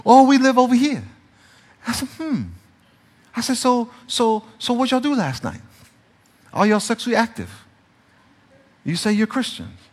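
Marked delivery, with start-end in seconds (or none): 0.0-7.5 mock casual pastor question. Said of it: "I might say".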